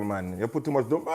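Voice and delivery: ordinary talk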